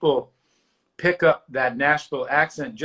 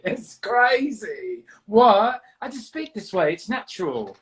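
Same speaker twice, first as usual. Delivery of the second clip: hammed-up posh British accent, slow and higher; mock affected British accent; 6 semitones higher, slower than usual. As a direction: parody a singer's fake British accent. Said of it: "she's talking like this all of a sudden".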